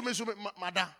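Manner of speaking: ordinary delivery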